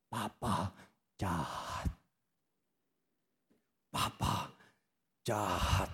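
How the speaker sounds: low, soft hurt accusation; quietly sulking child, dropping to a near whisper; sulky child. Retold or imitated. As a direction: mutter it like a wounded little kid. Child sulking after being left.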